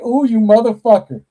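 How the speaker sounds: high, loud delighted "Ooh, you motherfucker"; excited outburst. Retold or imitated. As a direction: blurt it out, thrilled at the twist. Reacting to the show's twist.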